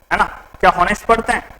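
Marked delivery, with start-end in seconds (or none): 0.7-1.1 हॉनेस्ट opening on an audible h; wrong pronunciation demo. Shown as a demonstration. Teacher asking students if it is read so, हॉनेस्ट with h sounded. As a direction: pronounce the h in हॉनेस्ट. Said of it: "नहीं पढ़ते हैं".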